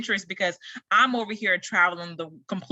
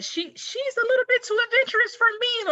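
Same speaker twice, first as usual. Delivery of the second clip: excited exclamation. Real, not acted. Aunt exclaims about daring niece.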